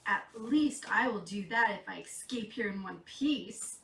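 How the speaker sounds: plain reading voice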